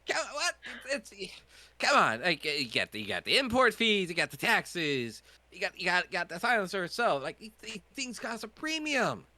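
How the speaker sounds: high, loud, outraged haggler; pushy shopkeeper. Acted. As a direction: defend the steep price, loudly. Shopkeeper NPC voice.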